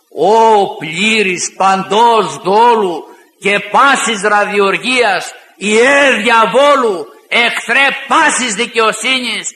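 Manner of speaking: loud, slow declaimed rebuke; holy anger. Acted. Paul denouncing the sorcerer who twists God's truth, with holy anger. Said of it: "φώναξε δυνατά, οργισμένος με Αγία Οργή".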